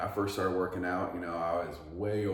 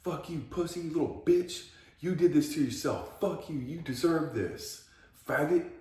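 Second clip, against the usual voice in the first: much higher, harsh mock drill sergeant; inner drill sergeant; 10 semitones higher, 4 dB louder than usual. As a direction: scream abuse at yourself like a drill sergeant. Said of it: "being my own little drill sergeant".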